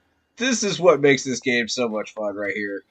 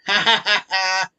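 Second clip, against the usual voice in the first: bright giddy burst of laughter; gleeful laugh; 6 semitones higher, 4 dB louder than usual. Real, not acted. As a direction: laugh out loud, delighted. Laughing at glitch.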